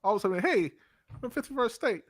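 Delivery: high, hammed-up Southern accent; bad mock Southern accent. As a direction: put on a broad, high-pitched Southern accent. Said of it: "It's a southern accent".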